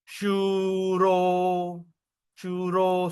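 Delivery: shuro with both vowels held long; pulled vowels. Shown as a demonstration. Teacher explains the O without a dash, drawn-out demo. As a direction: stretch shuro out on a level pitch. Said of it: "but you pull the raga".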